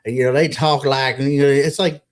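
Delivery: normal talking voice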